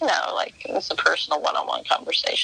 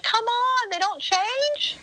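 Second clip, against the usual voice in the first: exasperated; much slower than usual. Retold or imitated. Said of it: "I was like".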